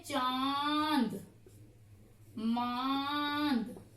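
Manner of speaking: चांद and मांद drawn out very slow with the vowel nasalised; nasal vowel demo. Shown as a demonstration. Teacher stretches चांद and मांद to show the nasal vowel. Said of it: "तो ये है अनुनासिक".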